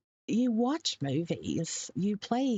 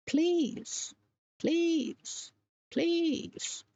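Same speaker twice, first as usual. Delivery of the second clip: high, pleading imitation, evenly repeated; mock desperate begging; 10 semitones higher, much slower than usual. Reading his postscript back to viewers, mock desperate pleading. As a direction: mimic someone desperate, pitched well up. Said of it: "What does that sound like to you?".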